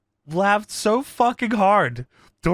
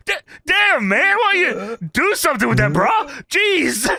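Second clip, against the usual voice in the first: higher-pitched disgusted complaint; Booker T; 7 semitones higher than usual. Retold or imitated. Podcaster imitating wrestler at the smelly stalls, mock disgust. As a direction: act out a mock-offended wrestler. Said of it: "Booker snaps his head back and says".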